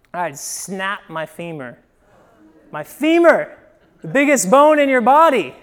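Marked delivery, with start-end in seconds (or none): 2.7-5.5 high, loud storytelling exclamation; loud disbelief. Retold or imitated. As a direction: stress how outrageous it is, loudly. Exclaims over his snapped femur.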